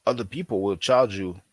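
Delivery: usual speaking voice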